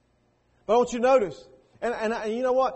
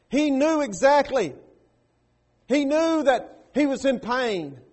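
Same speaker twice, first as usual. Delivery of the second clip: loud, raised preaching, hammering each point; preaching at full force; 6 semitones higher, 7 dB louder than usual. Acted. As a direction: drive it home from the pulpit.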